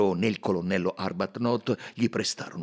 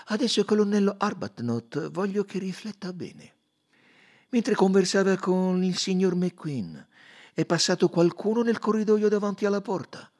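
higher, insistent detective voice; detective coaxing a witness, higher and louder; Poirot questioning; 13 semitones higher, 8 dB louder than usual. Acted. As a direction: press him gently but firmly, pitched up. Poirot presses the colonel.

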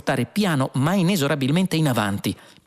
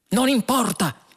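high-pitched impatient exclamation; exclaiming; 13 semitones higher than usual. A narrator's reading. Fuog brushes off the computer's warning, exclaiming. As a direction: cut in eagerly, voice jumping high. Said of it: "esclamò Fuog".

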